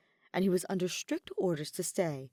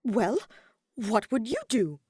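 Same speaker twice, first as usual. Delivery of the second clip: higher and louder, indignant; huffy challenge; 4 semitones higher, 5 dB louder than usual. A narrator's reading. Ferdinand huffily challenging Hubert, indignant. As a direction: demand it like a pouting noble. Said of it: "looked like he might stomp".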